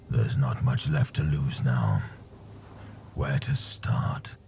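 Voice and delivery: low, slow, tired voice; weary warrior. Acted. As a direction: speak low and worn out. Wounded warrior speaking.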